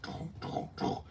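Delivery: whispered urgent go, go, go; hushed soldier commands. Retold or imitated. Stormtroopers urging each other down the street, in a hushed whisper. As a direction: push the squad forward under your breath.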